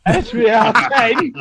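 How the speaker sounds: loud, high, drawn-out call; talking over someone. Real, not acted. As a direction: belt it out slowly over the interruption. Shouts code over interrupting co-host.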